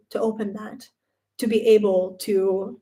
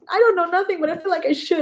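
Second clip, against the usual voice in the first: much higher, whiny and helpless; helpless whine; 9 semitones higher than usual. Retold or imitated. She answers her friend, high and helpless. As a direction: plead weakly like a lost kid.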